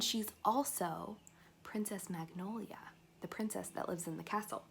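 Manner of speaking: unvoiced secret whisper; hushed reveal. Real, not acted. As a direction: let them in on the secret. Secret identity aside.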